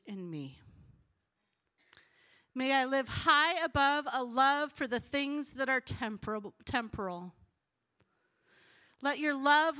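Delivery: earnest prayer, unhurried and deliberate; prayerful reading. Real, not acted. Reads a rewritten Puritan prayer aloud.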